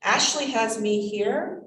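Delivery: normal talking voice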